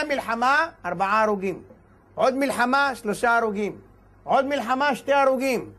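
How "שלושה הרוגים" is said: ordinary talk, nothing marked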